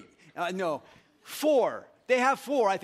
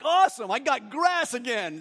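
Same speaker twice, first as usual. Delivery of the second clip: loud, higher-pitched mock delight; hammed-up enthusiasm; excited cow; 6 semitones higher, 7 dB louder than usual. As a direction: play a cow thrilled with its food. Voicing the happy cow.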